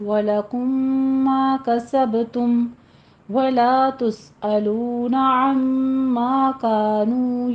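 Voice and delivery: slow, measured tajweed chant; Quran recitation. Shown as a demonstration. Teacher recites the verse for tajweed students, slow chant. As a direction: recite each phrase slowly, drawing out the vowels.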